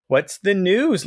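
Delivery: upbeat greeting to the arriving maid; cheerful question. A narrator's reading. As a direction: ask the news with a lift of cheer. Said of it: "asked cheerfully".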